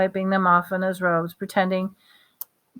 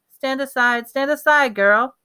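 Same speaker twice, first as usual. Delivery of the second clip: commanding; 6 semitones higher, 4 dB louder than usual. Acted. Voldemort commands Lily.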